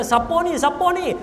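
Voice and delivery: high, startled squeak of panic; a debtor suddenly bagged from behind, yelping in alarm; panicked who's there. Acted. Man grabbed in the dark crying out in high panic.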